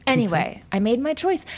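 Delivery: normal talking voice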